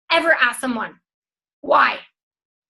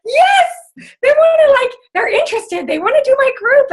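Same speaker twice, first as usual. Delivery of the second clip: much higher and louder, bursting with joy; thrilled new coach; 9 semitones higher, 4 dB louder than usual. Retold or imitated. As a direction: squeal it like you just won.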